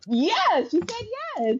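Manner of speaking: high-pitched happy announcement, acted out; retold excitement. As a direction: imitate a thrilled yes, pitch climbing. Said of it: "they were all excited".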